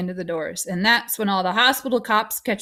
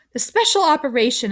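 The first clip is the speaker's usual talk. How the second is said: higher, pressing confrontation; insistent accusation; 6 semitones higher, 4 dB louder than usual. A narrator's reading. Boy confronting doctor about promised operation, insistent. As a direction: press her for an admission.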